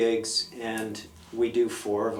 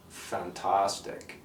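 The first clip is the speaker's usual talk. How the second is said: low, very slow, savoured word; slow blissed-out drawl; 4 semitones lower, 5 dB quieter, much slower than usual. Retold or imitated. Friend loving the industrial noise, low and slow. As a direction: let the word melt out slowly. And he goes.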